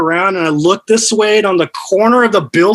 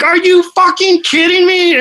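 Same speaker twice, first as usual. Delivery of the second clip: put-on angry girlfriend; 10 semitones higher than usual. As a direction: throw her disbelief back at him. Angry girlfriend voiced in story.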